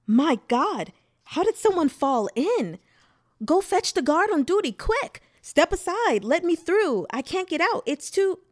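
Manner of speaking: high, loud panicked cries; frenzied crowd shouting. A narrator's reading. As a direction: shout in panic, pushing through the crush. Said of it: "the crowd by the river had become frenzied".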